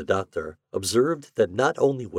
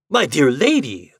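high, loud exclamation; crying out; 7 semitones higher, 6 dB louder than usual. A narrator's reading. Doctor correcting the offended widow, crying out. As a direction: cry out in hasty objection. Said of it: "the doctor cried".

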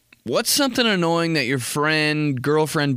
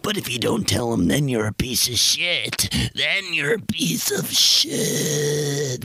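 slow drawn-out "piece of shit"; lightly voiced guilt-tripping conscience; nagging inner voice; slower than usual. The inside of him shaming him into speaking.

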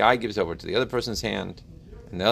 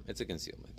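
whispered aside; 5 semitones lower, 18 dB quieter, faster than usual. Real, not acted. Whispered aside after stumble.

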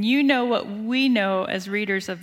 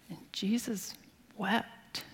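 hushed unvoiced whisper, much softer; 10 dB quieter, slower than usual. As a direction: breathe the verse out as a hushed secret. Solemn key verse read.

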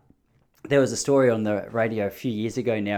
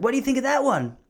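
imitating the dealer; 13 semitones higher, faster than usual. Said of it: "He's like".

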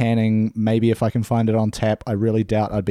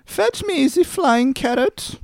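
very high, slow Python falsetto; Michael Palin; slower than usual. Acted. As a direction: draw it out in a silly squeaky voice. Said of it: "like, a Michael Palin, very high voice".

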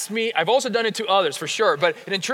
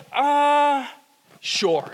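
high grudging "Uh," then a deflated "sure."; hesitate, then give in unwillingly; reluctant "uh, sure"; 6 semitones higher than usual. Retold or imitated. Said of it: "at first you're like".

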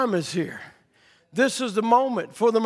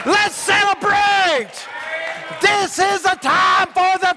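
full-force preaching; 8 semitones higher, 4 dB louder than usual. Acted. Preacher urging the congregation to rejoice, shouting. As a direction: shout the celebration over the congregation.

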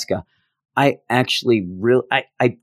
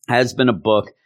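normal talking voice

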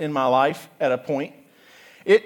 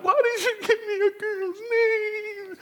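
put-on high wailing child's cry; tearful high-pitched protest; crying scream; 6 dB quieter than usual. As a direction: wail it like a hurt little kid.

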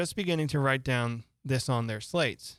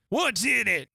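queen's sharp demand; 9 semitones higher, 4 dB louder than usual. A narrator's reading.

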